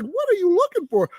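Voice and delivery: exasperated. Retold or imitated. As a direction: ask it in disbelief, way up high. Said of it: "I'm like".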